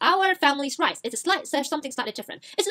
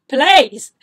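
loud plea; 12 dB louder than usual. Retold or imitated. Dad pleading to mom.

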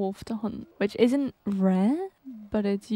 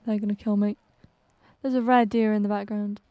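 normal talking voice

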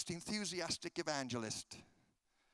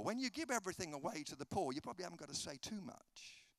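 ordinary talk

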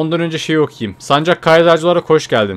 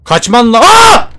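terrified scream; 7 semitones higher, 8 dB louder, much slower than usual. Real, not acted. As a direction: cut off by a high loud shriek. Sees monster, screams. (ani, tiz korku çığlığı)

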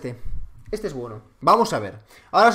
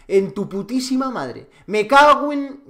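angry outburst; 5 semitones higher, 3 dB louder, slower than usual. Real, not acted. Raging at the game.

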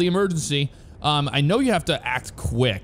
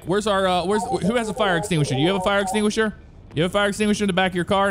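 rapid, higher, rushed questions; frantic rush; 6 semitones higher, much faster than usual. Real, not acted. Player frantically asking victims for an extinguisher. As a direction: hurry to find an extinguisher.

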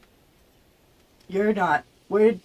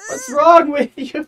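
loud, high-pitched incredulous cry; exasperated outburst; 8 semitones higher, 4 dB louder than usual. Real, not acted. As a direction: cry out at someone acting crazy. Exclaiming at game creature.

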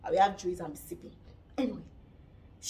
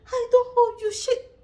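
heated retort; 10 semitones higher, 8 dB louder than usual. Retold or imitated. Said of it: "She said".

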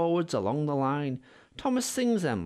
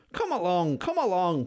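puffing Thomas; 5 semitones higher, 4 dB louder than usual. Acted. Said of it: "puffed Thomas".

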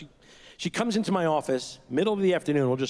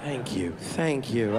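slow, lower, laughing through it; laughing thanks; 6 semitones lower, much slower than usual. Real, not acted. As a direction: laugh while thanking, moved. Touched by daughter's compliment.

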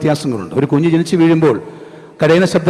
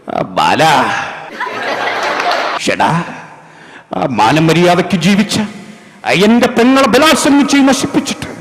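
Prem Nazir impression; 4 semitones higher, slower than usual. Judge confronting villain Bala over his sister, melodrama parody. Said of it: "പിന്നീട് കുറേ കാലം അദ്ദേഹം ജഡ്ജിയായിട്ട് അഭിനയിക്കും".

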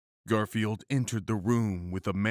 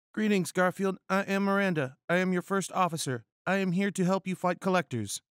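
high-pitched, brisk female character voice; eager first officer; 9 semitones higher, 3 dB louder, faster than usual. Acted. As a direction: introduce yourself in a high, hurried put-on voice. Figure of Miranda in the story.

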